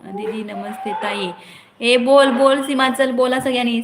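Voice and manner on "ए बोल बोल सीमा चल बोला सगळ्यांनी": shouting at viewers to talk, much louder; loud, eager summons to comment; loud call-out. Real, not acted. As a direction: call out to the whole chat. Calling viewers to speak up.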